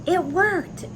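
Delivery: big happy reveal; excited. A narrator's reading. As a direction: burst out with delight for the kids. Story climax, experiment succeeds.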